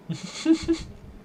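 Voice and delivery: high-pitched laugh at normal volume; giggling. Real, not acted. Player tickled by the art dialogue options, high giggle.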